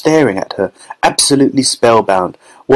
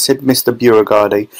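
ordinary delivery, nothing marked

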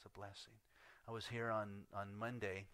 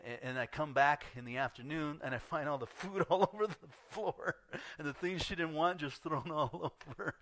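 ordinary talk

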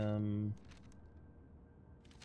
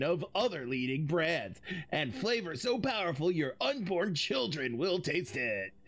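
laid-back surfer dude, higher; 4 semitones higher than usual. Acted. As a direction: hype it up like a stoner surfer. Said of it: "a surfer voice or a stoner voice".

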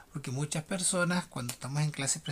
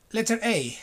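usual speaking voice

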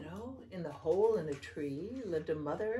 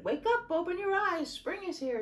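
high, loud excited wake-up call; shouted; 12 semitones higher, 5 dB louder, faster than usual. A narrator's reading. The children jumping on their parents' bed, shouting. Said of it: "and shouted".

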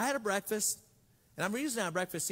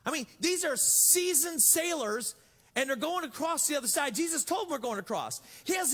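emphatic preaching; 7 semitones higher than usual. Real, not acted. Excited preaching peak.

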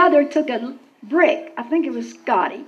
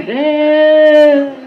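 echoing calls, a bit louder; echoing help; 2 semitones higher, 8 dB louder than usual. Retold or imitated. Girl's help echoing down the outhouse hole, louder. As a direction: let help ring out like an echo. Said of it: "It was going".

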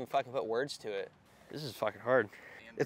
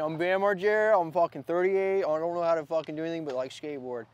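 higher, louder put-on skater voice, quick at the end; a hammed-up take on a famous skater; Bam Margera impression; 5 semitones higher, 7 dB louder than usual. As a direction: rattle it off as a cocky pro skater. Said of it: "and that doesn't work".

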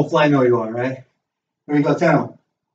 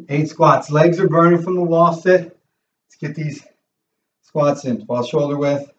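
ordinary talk, nothing marked

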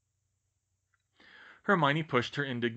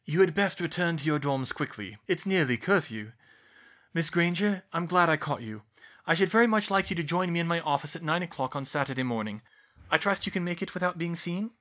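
higher-pitched, composed headmaster; Dumbledore's voice; 6 semitones higher, 4 dB louder than usual. A narrator's reading. Dumbledore invites Miss Granger to his office, high headmaster voice. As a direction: speak as the headmaster, pitch lifted throughout.

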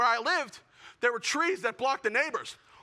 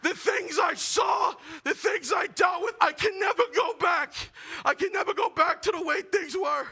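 shell-shocked veteran; 7 semitones higher than usual. Acted. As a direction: play the traumatized soldier recalling the trenches. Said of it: "looking like a shell-shock victim".

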